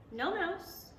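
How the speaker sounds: ordinary delivery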